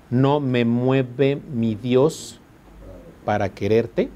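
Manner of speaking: slow, measured reading of a verse; poem recital. Shown as a demonstration. Teacher reciting a sonnet to the class, slow and measured. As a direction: recite it slowly, line by line.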